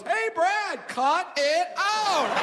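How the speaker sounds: high, loud mock telling-off, drawn out; hammed-up scold, stretched long. Coulier teasing Brad in the dressing room, mock scold.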